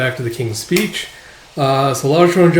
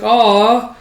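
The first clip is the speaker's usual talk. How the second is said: put-on disappointed child; 8 semitones higher, 4 dB louder than usual.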